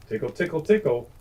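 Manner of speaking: high playful mouse voice, rising on each tickle; squeaky mouse tickle. Acted. Reading to children, the mouse squeaks tickle playfully. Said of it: "went the mouse".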